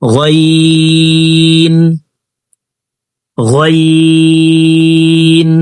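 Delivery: গইন stretched slow and sung; drawn-out letter. Shown as a demonstration. Teacher models the letter for students, slow chant. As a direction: chant গইন out long, three beats. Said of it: "তিন আলিফ টেনে পড়তে হবে".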